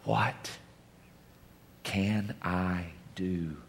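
soft, slow whispered question; tender whisper. Acted. Jesus asking the listener what he can do, tender whisper. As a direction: ask it gently, almost breathless.

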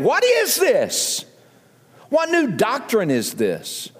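high, drawn-out astonished question; amazed questioning. A narrator's reading. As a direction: stretch it out in wonder. Amazed crowd voiced in scripture.

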